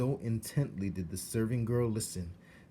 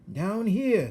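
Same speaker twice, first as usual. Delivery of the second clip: much higher, louder little rat voice; rat calling up; 13 semitones higher, 10 dB louder than usual. Acted. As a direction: call up from the floor like a tiny rat. Rat calls up from floor.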